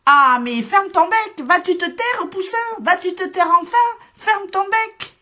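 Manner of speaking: loud scolding shout, pitched high; shouting together. A narrator's reading. Farm animals shouting down the little chick, loud and high. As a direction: yell at the chick to shut up. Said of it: "se mettent à crier".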